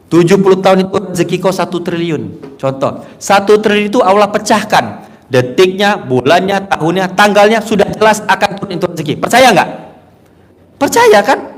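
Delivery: high, loud fervent preaching; preaching at full force. Acted. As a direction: drive the point home to the congregation.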